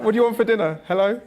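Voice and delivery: high, loud, eager questions, with a laugh; imitating a mother coaxing her son to keep talking; excited mum. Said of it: "She was like".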